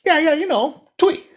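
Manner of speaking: very high, squeaky frog voice; high little storybook frog; eager frog. A narrator's reading. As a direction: explain it keenly to the tiger. Frog demonstrates spitting.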